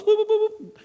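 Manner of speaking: high playful boop sounds for little boats; boat noises. Retold or imitated. Preacher imitating little boats leaving shore, high playful boops. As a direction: chirp the boops like tiny boats bobbing off.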